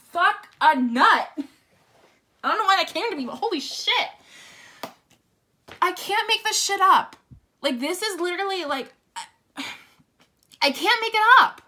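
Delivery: loud, high, stunned exclaims; overwhelmed by the cards; real excited disbelief. Real, not acted. Stunned by reading.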